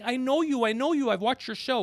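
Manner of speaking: high, loud star-struck recognition; excited fan. Retold or imitated. Manager at Chick-fil-A recognizing him, excitedly. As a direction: gush like a fan spotting a celebrity.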